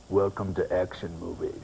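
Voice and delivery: low impression drifting into Scottish; Sylvester Stallone. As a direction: put on a low action-star voice. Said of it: "That's, that's a bit Sean Connery".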